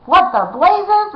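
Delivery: high, slow roar of outrage. A narrator's reading. Outlaw Billy roars at the intruders in the sewer, loud and high. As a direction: bellow it out in alarm. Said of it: "roared Hold Your Nose Billy".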